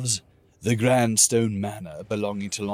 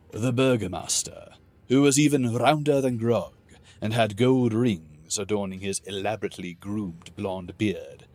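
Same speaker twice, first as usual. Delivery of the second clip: plain reading voice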